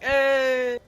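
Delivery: loud, high bar cheer; the bar patrons cheering a free round. Acted. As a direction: whoop like a happy patron.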